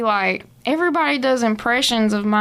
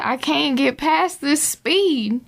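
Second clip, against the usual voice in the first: slow drawl; 4 semitones higher, slower than usual. Shown as a demonstration. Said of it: "Everything's real slow".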